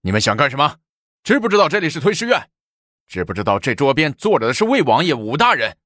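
high-pitched, indignant challenge; scolding. A narrator's reading. Emboldened official curses the soldiers, scolding. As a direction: berate the intruders, pulling rank. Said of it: "骂道".

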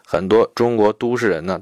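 usual speaking voice

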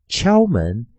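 ordinary talk

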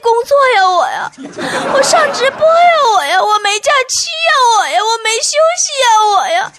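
high-pitched, hammed-up plaintive wail; mock lament. Comedian bemoans the hard youth to the audience, mock lament. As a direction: whine out each hardship in a comic singsong.

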